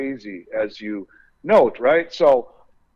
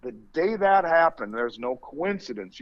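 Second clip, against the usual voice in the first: ordinary delivery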